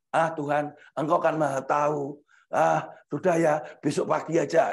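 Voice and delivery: low, unwilling brush-off; mock a sleeper putting God off, low voice; reluctant prayer. Sleepy believer telling God tomorrow morning, low reluctant voice. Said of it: "pasti ngomong sama Tuhan gini".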